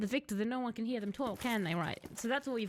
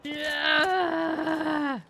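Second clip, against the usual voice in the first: loud, strained cry of frustration; anguished cry; 3 semitones higher, 6 dB louder than usual. Real, not acted. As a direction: let out a pained yell. Frustrated cry after realization.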